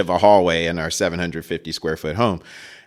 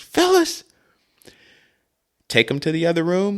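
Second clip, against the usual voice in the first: furious dad; 10 semitones higher than usual. Retold or imitated. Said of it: "He goes".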